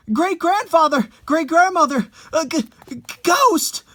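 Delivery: high, loud stammering in fright; panicked cry. Acted. Terrified wife cries to the elders and stammers ghost. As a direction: call out for help, then choke on ghost.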